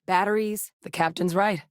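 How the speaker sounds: ordinary talk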